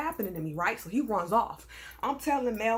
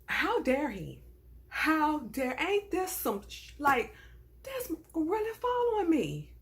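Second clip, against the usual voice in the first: higher-pitched, worked-up indignation; infuriated; 6 semitones higher, slower than usual. Retold or imitated. Shopper fuming at the manager trailing her, infuriated. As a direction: let the outrage climb until words break off. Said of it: "I'm getting infuriated".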